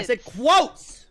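loud, high, stretched-out correction; exasperated yell. Real, not acted. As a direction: shout it back, dragging the last word. Arguing over formatting.

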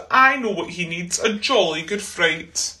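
plain reading voice

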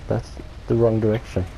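ordinary talk, nothing marked

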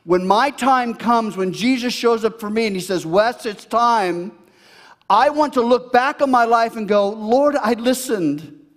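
loud, high preaching cry; preaching at full force. Acted. Pastor preaching to his church, loud and high. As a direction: proclaim it to the congregation.

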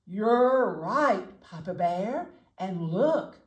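a house-proud mother bear getting worked up; Mama Bear. Acted. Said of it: "said Mama Bear".

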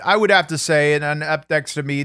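ordinary talk, nothing marked

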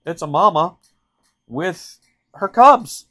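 suddenly spotting a mama bear, rising alarm; retold alarm. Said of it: "and I thought".

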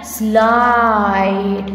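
"Slide" low, with a long middle vowel; pronunciation demo. Shown as a demonstration. Teacher showing how we pronounce it, drawn-out demo. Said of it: "we will pronounce like that".

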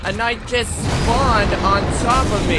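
high, loud, slow protest; aggrieved gamer yell; outraged. Real, not acted. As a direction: stretch it into a loud indignant wail. Outraged as a knight appears.

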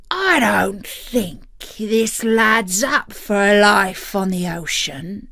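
slow pirate drawl; serious pirate captain voice; pirate captain, grave. A narrator's reading. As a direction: pass judgement as a stern old pirate.

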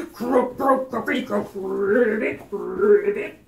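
loud croaking frog noises; loud, croaky frog hello; frog croaks. Pretend. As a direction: croak and ribbit like a jumpy frog. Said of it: "say hello like a frog".

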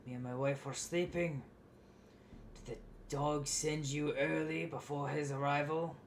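woken father snapping at an unwelcome visitor; irritated father. Acted. Game master voices the irritated father.

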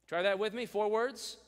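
ordinary talk, nothing marked

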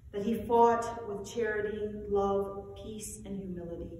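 solemn eucharistic prayer; liturgical recitation. Acted.

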